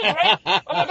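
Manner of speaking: usual speaking voice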